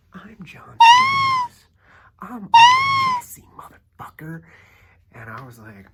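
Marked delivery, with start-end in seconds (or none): 0.1-4.4 mock drunk celebrity bellowing, much higher and louder; hammed-up screaming self-importance; John Stamos yelling. Mocking the drunk actor at the server, a hammed-up yell.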